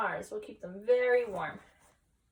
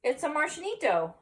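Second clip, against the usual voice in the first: amazed; 6 semitones higher than usual. Acted. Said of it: "gulped Scapito".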